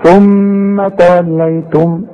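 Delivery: ثم with its م humming for two beats; nasal ghunna. Shown as a demonstration. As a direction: hold the ghunna on ثم. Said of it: "وأنت سمعتها".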